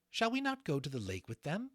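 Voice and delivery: Lily's voice. Acted. Lily asks her captain.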